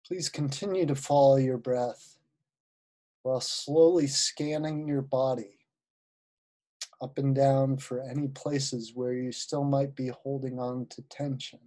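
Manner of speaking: unhurried guided-visualization voice with long pauses; guided meditation. Acted. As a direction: ease listeners into relaxation, phrase by phrase.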